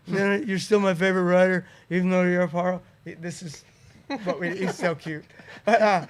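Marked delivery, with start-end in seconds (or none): 0.1-2.8 loud, whiny near-tears plea; mock crying, pleading and loud; Dave Mirra crying voice. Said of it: "the crying voice".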